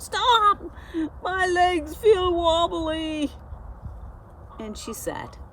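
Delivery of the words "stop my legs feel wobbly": high, loud cry of alarm, drawn out on the wobbly legs; a cow crying stop as her legs give way; cried out. Acted. Clover crying out under the stacked animals, high and loud. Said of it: "cried out".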